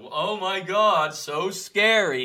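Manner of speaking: hammed-up fake fear, mocking the tough biker; mocking fake fright. Teasing the biker who flipped him off, mock scared. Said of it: "Like, like the".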